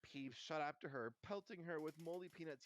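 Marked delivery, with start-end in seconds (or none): none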